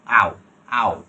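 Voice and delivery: "আও" called out as a strong au glide; aʊ diphthong. Shown as a demonstration. Teacher calling out the ao sound for the class. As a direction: belt out "আও" twice. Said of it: "এরপরে sound হচ্ছে".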